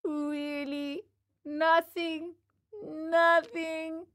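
high, stretched-out disappointed questions; whining puppet child. Acted. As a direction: draw each word out high and plaintive.

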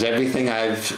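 normal talking voice

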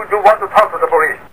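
normal talking voice

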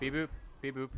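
goofy beep-boop robot. Pretend. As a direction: beep like a little droid. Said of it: "so that's why we were sounding stupid".

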